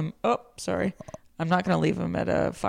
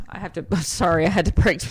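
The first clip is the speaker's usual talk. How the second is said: ordinary talk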